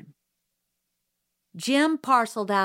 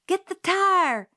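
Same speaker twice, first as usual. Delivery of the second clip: high, loud, slow holler; 10 semitones higher, 4 dB louder than usual. A narrator's reading. Jim hollers at Scout to grab the tire. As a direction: holler the order. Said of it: "Jim hollered".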